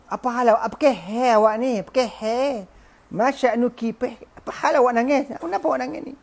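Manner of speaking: high-pitched, insistent husband's questions; bewildered questioning. Acted. Husband asks his crying wife why, bewildered questioning.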